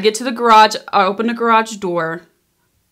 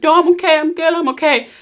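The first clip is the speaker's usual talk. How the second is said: much higher, strained I'm okay; crying girl waving off concerned strangers, much higher; tearful reassurance; 9 semitones higher, 5 dB louder, faster than usual. Retold or imitated. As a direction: brave face through tears, pitched way up.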